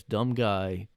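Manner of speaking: usual speaking voice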